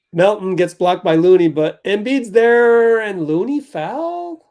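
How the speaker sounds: loud, quick game call; play-by-play. Acted. Calls the game live.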